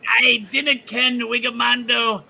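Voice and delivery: a cheesy fake Scottish accent, proudly bad; bad Scottish brogue. Announcing the next duo in a bad Scottish brogue. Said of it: "very bad Scottish brogue".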